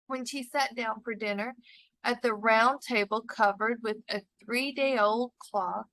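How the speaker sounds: usual speaking voice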